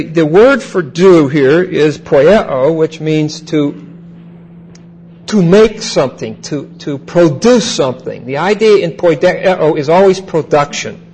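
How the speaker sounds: ordinary delivery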